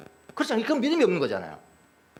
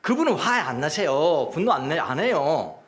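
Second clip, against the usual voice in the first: normal talking voice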